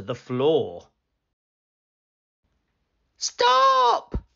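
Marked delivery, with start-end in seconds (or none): 3.2-4.2 loud, very high panicked shout; shrieked command; boy's cry. A narrator's reading. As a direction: cry out high as the alarmed boy. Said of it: "cried the boy".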